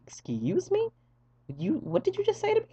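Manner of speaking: very high-pitched affronted disbelief; incredulous. Retold or imitated. Patient hearing what he did on waking, incredulous. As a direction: squeak out mock-offended shock. Retold disbelief at being told.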